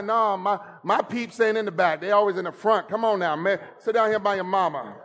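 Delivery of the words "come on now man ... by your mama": ordinary talk, nothing marked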